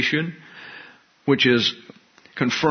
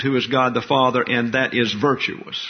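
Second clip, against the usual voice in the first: ordinary delivery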